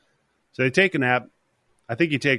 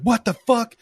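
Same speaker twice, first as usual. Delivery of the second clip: high, loud startled yell; retold panicked yell; alarmed shout; 11 semitones higher, 5 dB louder than usual. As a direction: shout it in shock as you burst in. Mike rushes in, alarmed.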